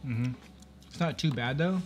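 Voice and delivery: talking between chews; words slightly muffled by food; mouth full, chewing. Real, not acted. Seen 0: Woman bit burrito at -4s, cheeks still working while replying.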